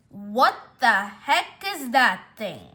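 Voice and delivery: slow, every word hit separately; appalled disbelief. Acted. Kitty seeing the surprise, slow appalled emphasis. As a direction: punch out "What the heck" word by word.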